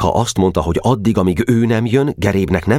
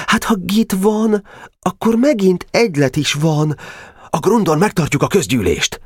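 put-on excited boy. Weiss telling the boys the club lives on, eager. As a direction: announce the plan gleefully.

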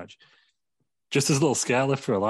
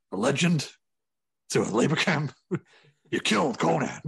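a touch of an exasperated boss ranting; mock angry rant; 3 semitones higher than usual. Vince scolding Kim Jong-un, mock exasperated. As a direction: vent like a fed-up promoter.

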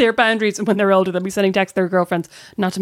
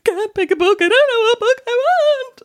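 put-on upset child; 14 semitones higher than usual. Mum imitating her kid's bookshop meltdown, high upset wail. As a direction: imitate a distraught child, pitched way up. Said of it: "got really upset in the bookshop".

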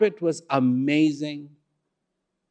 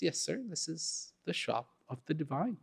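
hushed shopkeeper; 14 dB quieter than usual. Acted. Shopkeeper names his shop.

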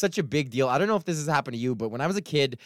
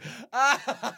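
laugh; 9 semitones higher than usual. Real, not acted. Laughs at himself mid-rant.

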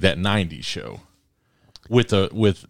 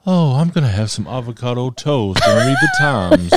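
imperious plummy grande dame; 6 semitones higher, 4 dB louder than usual. Pretend. Imagining mature self, posh tone.

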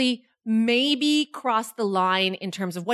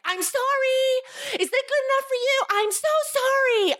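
yelling. Retold or imitated. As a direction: re-enact yelling sorry after a fleeing runner. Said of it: "I kept yelling at him".

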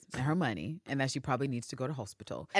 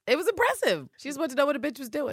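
gushing; 11 semitones higher, 4 dB louder, faster than usual. Real, not acted. Gushing about Villanelle.

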